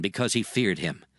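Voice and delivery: normal talking voice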